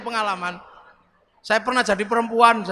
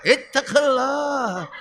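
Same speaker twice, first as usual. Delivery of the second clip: slow, heavy warning; Umar's stern sermon; much slower than usual. Retold or imitated. Umar warning the congregation in his sermon, slow and heavy. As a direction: drag out the warning like a stern preacher. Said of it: "Kalau khutbah itu pedangnya".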